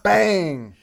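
loud, high Batman punch noises; comic book sound effects. Pretend. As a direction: shout out the TV fight sounds.

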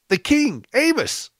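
high-pitched, insistent excitement; exuberant. Retold or imitated. Visitor explains to the puzzled pastor, exuberant. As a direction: re-enact an exuberant answer, pitch leaping up. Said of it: "I was just exuberant".

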